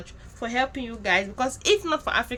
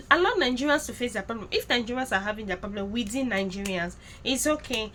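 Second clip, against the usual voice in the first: normal talking voice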